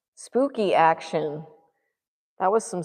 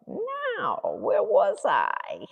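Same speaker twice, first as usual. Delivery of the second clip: slow, stretched-out words; drawn out; 3 semitones higher, much slower than usual. Real, not acted. Painter asking herself where she was, drawn out. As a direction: draw each word out slowly.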